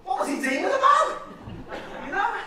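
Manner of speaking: high, loud burst of baffled disbelief; comic incredulity. Acted. Comedian to audience about a lad's hoard of stolen gloves, incredulous. Mock outrage at absurd theft.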